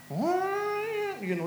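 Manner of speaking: high, long held note like a bagpipe; imitated bagpipe cry. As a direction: hold one high note like pipes over the mountains. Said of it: "that sound".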